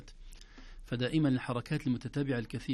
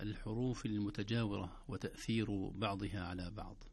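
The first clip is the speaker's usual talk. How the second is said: normal talking voice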